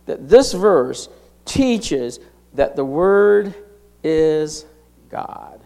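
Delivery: slow, with pauses before "is" and "God"; deliberate word-by-word "Word ... is ... God"; emphasizing. Real, not acted. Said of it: "why am I emphasizing that".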